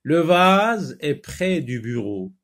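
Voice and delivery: slow, loud reading of a sentence; model reading aloud. Shown as a demonstration. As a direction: stretch the words out for the class. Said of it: "Relis les phrases".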